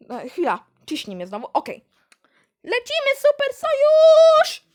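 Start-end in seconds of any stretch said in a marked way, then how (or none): 2.6-4.6 high, loud, stretched-out whoop; gleeful cry. Acted. Camper whooping to her ally as they slide. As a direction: shout it jumping down the slide.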